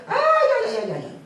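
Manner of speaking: laughing mock wail of hired mourners; hired mourners' wail. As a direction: ham up a paid mourner's cry. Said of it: "Y llegaban así".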